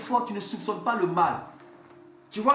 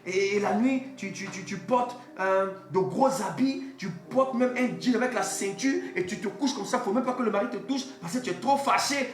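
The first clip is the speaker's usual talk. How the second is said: preaching at full force; 6 semitones higher than usual. Acted. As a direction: preach it out, driving each point home. (prédication enflammée, voix haute et forte)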